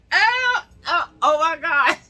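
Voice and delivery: overjoyed near-sobbing cry; overwhelmed shriek. Retold or imitated. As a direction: shriek like you can't believe the gift. Handed two hundred dollars.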